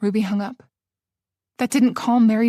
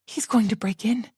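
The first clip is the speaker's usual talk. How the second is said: hushed, breathless fear; terrified whisper; 3 dB quieter than usual. Acted. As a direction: whisper it as he pounds. Panicked whisper.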